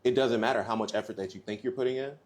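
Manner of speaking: usual speaking voice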